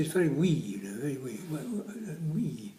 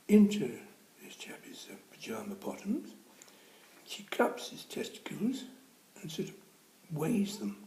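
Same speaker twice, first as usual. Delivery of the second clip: hushed telling; 8 semitones higher than usual. A narrator's reading. Risqué punchline detail told hushed.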